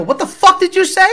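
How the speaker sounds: re-enacted sharp comeback to an insult; confrontational retort. Himself snapping at a stranger who insulted him, re-enacted challenge. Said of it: "And I go".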